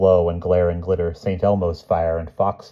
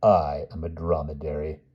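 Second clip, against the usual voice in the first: lightly voiced camel, softer; slightly lowered, soft animal character voice; talking camel; 3 semitones lower, 5 dB quieter than usual. A narrator's reading. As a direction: speak softly as an unruffled camel. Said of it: "the camel said".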